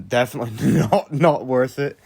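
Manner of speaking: ordinary delivery, nothing marked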